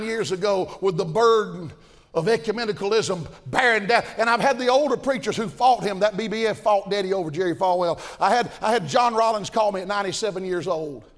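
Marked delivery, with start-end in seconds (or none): none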